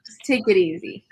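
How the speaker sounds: hushed, breathy whisper; whispered aside. Real, not acted. Quiet aside after laughing.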